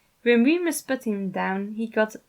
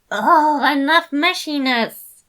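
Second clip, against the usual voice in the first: loud, high, slow protest; impatient exclaim; 7 semitones higher, 10 dB louder, slower than usual. A narrator's reading. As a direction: shout it, fed up with the sweetness. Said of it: "Remus exclaimed impatiently".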